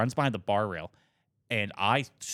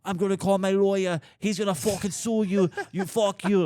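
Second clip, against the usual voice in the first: high-pitched, louder mock Irish ranting; imitated angry Irish drunk; 10 semitones higher, 4 dB louder than usual. Drunk Irishman threatening the man who threw him out, high mock rant. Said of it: "full-on Irish guy".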